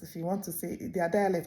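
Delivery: ordinary delivery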